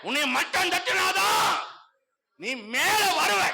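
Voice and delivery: high, loud preaching cry; preaching shout. Real, not acted. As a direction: lift the crowd with it. Preacher rousing the congregation. (உரத்த பிரசங்கக் குரல்)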